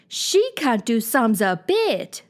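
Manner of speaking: slow, every word pressed; great emphasis. Acted. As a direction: hammer it home slowly. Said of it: "with great emphasis".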